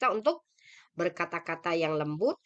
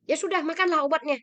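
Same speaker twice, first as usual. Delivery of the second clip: higher, louder, snappish "makanlah obatnya"; mock harsh order to a parent, raised voice; harsh tone demo; 8 semitones higher, 4 dB louder, faster than usual. Shown as a demonstration. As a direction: show how a rude child tells a parent to take medicine. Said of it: "ucapan intonasinya kasar".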